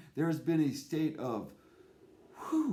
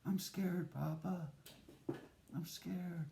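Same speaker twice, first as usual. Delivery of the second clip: put-on scared child; 3 semitones higher, 10 dB quieter than usual. Child repeats fear to father.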